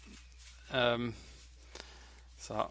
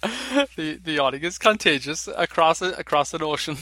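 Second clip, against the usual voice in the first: laughing; 4 semitones higher, 8 dB louder than usual. Real, not acted. Laughing about contagious yawning.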